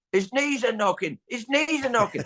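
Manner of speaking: high, mocking Cockney chant; Cockney punk taunt. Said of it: "these Cockney punks down the front are going".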